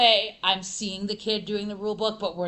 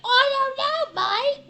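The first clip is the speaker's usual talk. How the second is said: high, loud put-on English "mate" voice; jokey British accent, loud and high; mock English accent; 9 dB louder, slower than usual. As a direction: ham up a cockney accent. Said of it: "where in England was your accent from".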